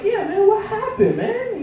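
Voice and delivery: acted high-voiced puzzlement; high-pitched questioning. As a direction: plead for answers way up high. Acting himself questioning dealer on phone.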